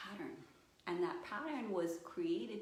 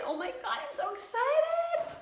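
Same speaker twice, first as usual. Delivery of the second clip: loud, very high excited squeal; wildly excited; 10 dB louder than usual. Shown as a demonstration. Showing students how excitement talks, wildly excited. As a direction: shriek it out, bursting with excitement. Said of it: "What does it talk like?".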